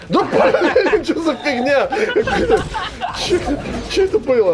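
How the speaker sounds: high laughing complaint; laughing exasperation. Real, not acted. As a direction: laugh it off in disbelief. Laughing at game mishap. (смеясь с досадой)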